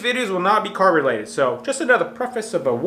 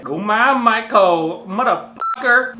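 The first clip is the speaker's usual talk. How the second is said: Fat Dong accent impression; 4 semitones higher, 5 dB louder, slower than usual. Vlogger retrying Fat Dong's voice, in an exaggerated accent. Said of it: "it sounds kinda like him now".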